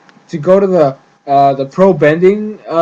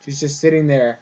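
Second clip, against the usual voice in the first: usual speaking voice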